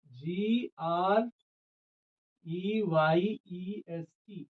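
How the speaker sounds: letters spelled out one by one, very slow; slow spelling. Shown as a demonstration. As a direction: spell each letter slowly for the class. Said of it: "और ग्रेएस्ट". (धीरे-धीरे अक्षर बोलना)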